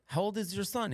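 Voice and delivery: high-pitched Russian lady impression. Russian lady asking dad about his son, high and accented. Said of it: "this Russian lady".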